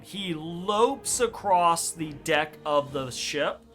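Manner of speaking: ordinary talk, nothing marked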